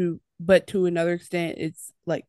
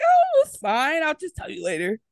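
mock breezy brush-off; 9 semitones higher than usual. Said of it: "he makes it like".

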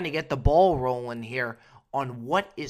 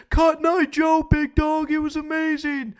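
put-on much higher, louder giddy drunk voice; drunk friend; 12 semitones higher, 8 dB louder than usual. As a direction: gush like a wasted buddy on the dance floor. Retold drunk friend's answer.

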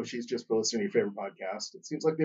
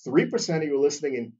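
ordinary talk, nothing marked

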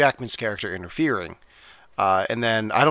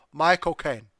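"My cocaine" in a clipped mock British accent; 3 semitones higher than usual. Shown as a demonstration. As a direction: say "My cocaine" as Michael Caine would. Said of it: "so it comes out".

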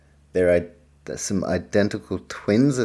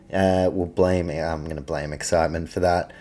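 ordinary talk

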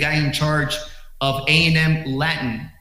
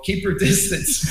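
wary warning; 5 semitones higher, slower than usual. Retold or imitated. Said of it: "so I was like".

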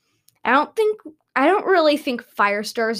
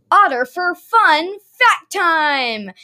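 loud slow announcer; 6 semitones higher, 5 dB louder, slower than usual. Acted. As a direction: announce it like a game show. Segment announcement.

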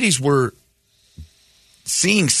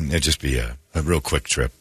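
ordinary talk

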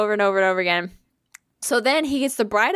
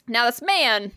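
usual speaking voice

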